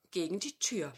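ordinary delivery

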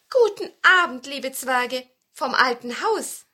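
laut grüßende Märchenhexe, oben ansetzend; grüß hoch und gedehnt als Hexe; Hexe Tannenmütterchen. A narrator's reading. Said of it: "die Hexe Tannenmütterchen sagte". (schwungvoller, lauter Hexengruß)

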